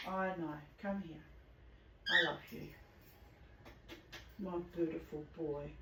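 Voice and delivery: calm him down gently after the fright; soothing pet talk. Real, not acted.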